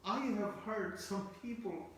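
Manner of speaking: usual speaking voice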